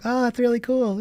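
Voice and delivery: very high-pitched mock approval; gullible admirers. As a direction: imitate impressed classmates in a high put-on voice. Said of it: "People go like".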